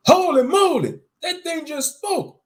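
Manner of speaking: high, loud shocked shout. Acted. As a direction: yell it out in shock. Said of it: "I shouted while shocked".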